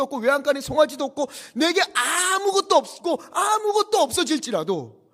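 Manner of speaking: high, loud, mounting litany of loss; full-force preaching. Acted. Preacher recites the prophet Habakkuk's confession, full-force preaching. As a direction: build urgently and stretch the final phrases.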